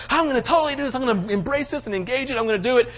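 high, gushing promise; zealous and passionate. Acted. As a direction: overdo the eager commitment. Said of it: "All excited, all zealous, all passionate".

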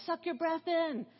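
put-on mother cramming kids into a van, high urgent call; urgent mom packing kids. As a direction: hurry the kids into the tiny van. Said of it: "I was like".

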